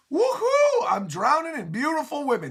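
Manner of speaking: squealing lovestruck cheer; ecstatic whoop. Acted. Sanji swooning among mermaids.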